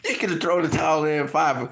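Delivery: ordinary talk, nothing marked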